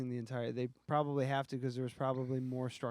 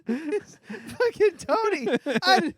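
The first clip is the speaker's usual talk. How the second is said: laughing; 13 semitones higher, 9 dB louder, slower than usual. Real, not acted. Laughing at Tony's joke.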